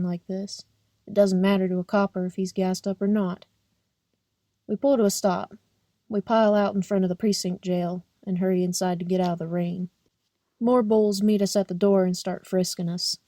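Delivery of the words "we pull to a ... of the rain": usual speaking voice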